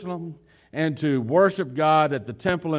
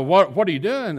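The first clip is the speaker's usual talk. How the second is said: louder and higher, a mock exasperated mother; 5 semitones higher, 6 dB louder than usual. Mother scolding her runaway twelve-year-old, mock exasperated outburst. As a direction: bark it like a mom who lost her kid at the store.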